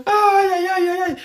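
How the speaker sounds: theatrical high-pitched lament; mock wail. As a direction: cry out in mock pain, very high. Mock wailing after the impression.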